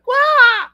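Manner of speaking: high, loud yelp of pain; imitated squeal. Shipmate hit across the back: a sharp, high squeal of pain. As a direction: squeal like you've just been whacked across the back.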